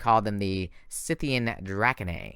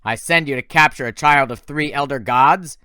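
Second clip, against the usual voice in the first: louder scornful general; the general berating his failed servant; 3 semitones higher, 7 dB louder than usual. Acted. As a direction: tear into him with scorn.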